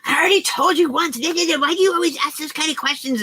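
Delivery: high, mock snappy yell at mom; imitating angry yelling. Niece snapping at elderly mom, mock angry yell. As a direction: ham up the niece snapping at her mother. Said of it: "she loses it and yells at her".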